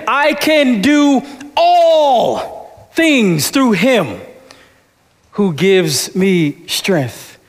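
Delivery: very slow, "all" lifted, a long pause after "him"; stress on ALL. Shown as a demonstration. Pastor showing the church a stress on "all". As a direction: stretch "all" and let "him" hang. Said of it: "how you can emphasize every word".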